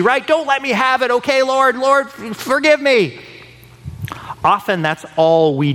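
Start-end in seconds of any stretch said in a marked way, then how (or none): 0.3-3.2 mock a sinner pleading to dodge punishment; whiny self-pitying prayer. Sinner begging God to avoid consequences, whiny plea. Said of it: "David didn't say".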